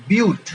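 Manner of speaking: বিউট said as "biut", not like English "but"; pronounced byoot. Shown as a demonstration. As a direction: say বিউট with a clear "bi-ut". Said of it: "কিন্তু উচ্চারণ হবে বিউট". (বিউট উচ্চারণ, "বাট" নয়)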